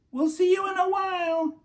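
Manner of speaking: high sing-song parent farewell; parent's goodbye. Acted. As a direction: wave the kids off sweetly.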